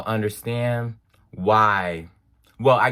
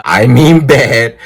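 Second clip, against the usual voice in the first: loud laugh through the word; 16 dB louder, slower than usual. Real, not acted. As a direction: burst out laughing. Laughs at own slip.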